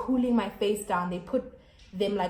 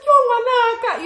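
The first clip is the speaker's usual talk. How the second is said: high, loud pitying wail; distressed mom; 14 semitones higher, 10 dB louder than usual. Retold or imitated. Mom so sad for her injured daughter, distressed wail. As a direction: cry out like a mother hurting for her child. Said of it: "sad for me".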